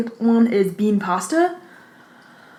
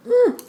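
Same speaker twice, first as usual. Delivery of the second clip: high delighted hum of taste. Real, not acted.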